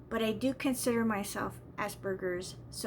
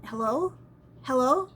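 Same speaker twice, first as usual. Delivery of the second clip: louder, rising call into the line; 5 dB louder than usual. Retold or imitated. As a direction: call out to an empty phone line. Calling into silent phone line.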